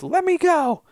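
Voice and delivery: high, loud hysterical cry; hammed-up desperate yell; mock yell. Retelling her yelling at the other woman, mock hysterical yell. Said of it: "she yells to Kate".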